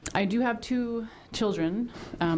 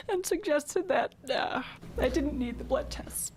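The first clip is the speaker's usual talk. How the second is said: words pushed out through tears; real tears while testifying; crying; 4 semitones higher than usual. Real, not acted. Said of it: "I don't know why I'm getting emotional".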